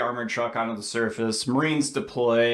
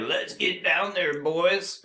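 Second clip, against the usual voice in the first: higher-pitched mock-giddy marine; giddy excitement; 5 semitones higher, slower than usual. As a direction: imitate a soldier itching to drop in. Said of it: "is just giddy with excitement".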